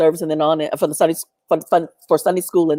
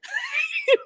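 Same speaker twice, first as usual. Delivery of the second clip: short high laugh; 7 dB quieter than usual. Real, not acted. Laughs at preaching family.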